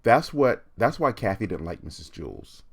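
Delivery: ordinary talk, nothing marked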